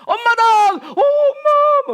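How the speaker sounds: very high, drawn-out mock wail; hammed-up spirit voice, loud and stretched; shaman impression. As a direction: wail like a shaman possessed by a dead mother. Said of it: "무당들 가면 그러잖아요".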